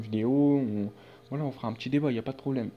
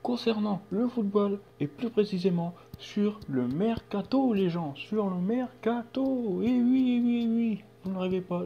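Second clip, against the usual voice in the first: raised, upbeat YouTube intro voice; hyped intro; 8 semitones higher, 4 dB louder than usual. Acted. Greeting his viewers at the start, upbeat host voice. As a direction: open the video like a show host.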